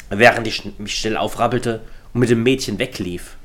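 plain reading voice

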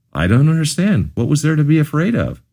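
father caught off guard, asking gently; a touch of alarmed surprise; surprised. Acted. Father hears daughter left alone.

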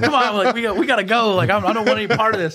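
high, lightly voiced pleading cellmate; a hint of a scared inmate begging to quit; panicked inmate. Cellmate begging the holdout to surrender, mild mock panic. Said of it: "a cell in there going".

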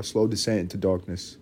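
normal talking voice

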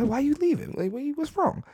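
high-pitched, squeaky plea, acted out; mock bewildered pleading. Man asking his departing date what's wrong, mock high-pitched pleading. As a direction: replay your own puzzled protest way up high. Acting out his surprised reaction.

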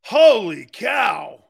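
slow drawn-out shouted holy cow; loud disbelief. Real, not acted. Host shouting his shock at New York fans. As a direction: bellow it in disbelief.

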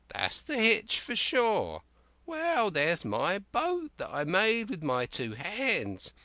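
high, slow, cracked voice of a wild marooned sailor; Ben Gunn. Acted. Marooned Ben Gunn eagerly pleading with young Jim, high and slow.

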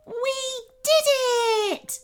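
high, spaced-out victory cheer. A narrator's reading. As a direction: cheer each word separately. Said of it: "cheered the puffin".

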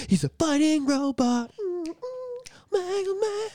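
high excited Mega Man chant; excited fanboy. Real, not acted. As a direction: hype it up like a fan.

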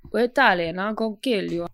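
lightly voiced mock Don Corleone accent; a hint of a bad Italian gangster; mock Italian mobster. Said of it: "Why is he doing that voice?".

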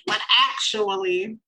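slow, mocking nerd voice; know-it-all voice. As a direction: drawl it like a pedant. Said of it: "they be like".

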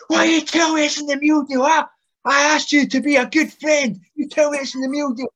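high-pitched angry tirade; berserk rant. Retold or imitated. Johnny raging at Aspen over the meal deal, high furious rant. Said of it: "Johnny just loses it".

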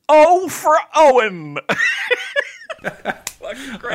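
high, slow, laughing groan of the name; exasperated teacher. Retold or imitated. Teacher reacting to a student who skipped homework, high and slow with laughter. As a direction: sigh out the name in mock despair. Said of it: "He goes".

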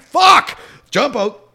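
a sharp, high, loud panicked yelp; retold panicked outburst; panicked curse. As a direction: spit it out in sudden panic. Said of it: "I'm just panicked now".

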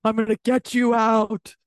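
hammed-up, drawn-out promise; mock obsessive girlfriend. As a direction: draw it out like an obsessive lover. Mimics obsessive girlfriend's vow.

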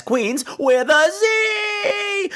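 high, slow shout on the Z; emphatic cry of Z; shouted correction. Acted. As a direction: yell it out so nobody misspells it. Spelling out the show's name.

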